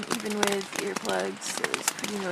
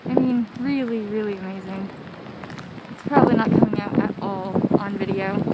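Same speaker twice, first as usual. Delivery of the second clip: muffled through a face buff; 9 dB louder than usual. Real, not acted. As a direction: speak through cloth over mouth. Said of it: "Sorry if my voice is muffled".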